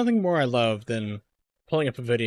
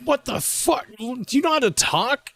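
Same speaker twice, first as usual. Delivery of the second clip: much higher, louder outraged yell; mock outraged yell; 4 dB louder than usual. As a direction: squeal it out like a fed-up viewer. Mocking the mumbling tutorial guy.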